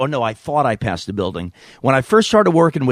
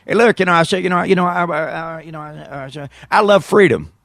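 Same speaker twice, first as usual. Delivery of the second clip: higher, stammering president groping for words; George Bush impression; 6 semitones higher than usual. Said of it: "watching George Bush".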